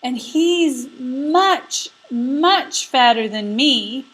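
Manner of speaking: high, slow stretched goat voice; little goat selling out his brother; bleating little goat. Acted. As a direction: draw out much like a pleading kid goat. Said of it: "the little billy goat bleated".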